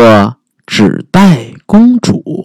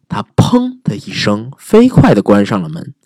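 ordinary delivery, nothing marked

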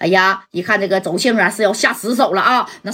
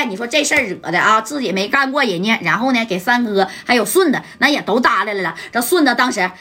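usual speaking voice

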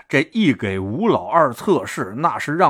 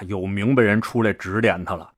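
ordinary delivery

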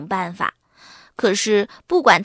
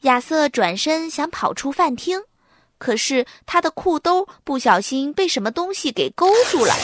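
plain reading voice

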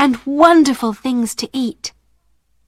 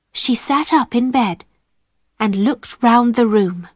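ordinary delivery

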